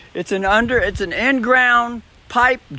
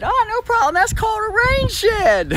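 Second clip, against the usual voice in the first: high sweet customer-service voice; chirpy put-on voice of a friendly agent; cheerful agent voice, pitched way up; quoting the insurance lady. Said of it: "And she said".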